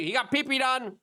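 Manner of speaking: high mocking voice imitating the media crowd; mocking gossip. Host imitating everyone's gossip, high and mocking. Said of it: "everyone's like".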